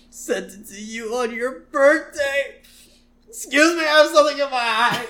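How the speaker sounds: high choked-up mock voice; stifle mock tears; imitating a wounded assistant; hurt Smithers.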